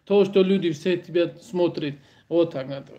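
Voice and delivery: usual speaking voice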